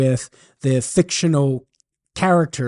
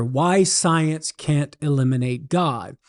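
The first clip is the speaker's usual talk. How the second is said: normal talking voice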